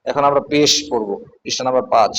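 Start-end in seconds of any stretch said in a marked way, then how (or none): none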